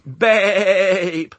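very high, loud flock crying out; great cry. A narrator's reading. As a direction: shout the name in joyful chorus. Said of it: "a great cry of".

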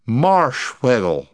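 tipsy insistence. Acted. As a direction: insist it, a bit drunk.

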